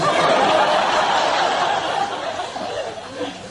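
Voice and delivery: long, loud, high wheezing laugh; real big laugh. Real, not acted. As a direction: crack up helplessly, high and breathless. Cracks up over the Queen joke.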